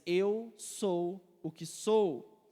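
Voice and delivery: very slow, weighty scripture reading; solemn declaration. Acted. Preacher reads God's answer to Moses, solemn and very slow. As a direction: give each word room, drawn out deliberately.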